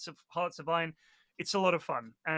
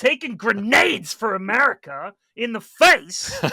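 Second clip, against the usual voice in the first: loud, high, slow teasing proclamation; mock-heroic tribute; 4 semitones higher, 10 dB louder, slower than usual. Teasing Austin about the front lines, mock-heroic shout. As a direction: proclaim it as a hammed-up war tribute.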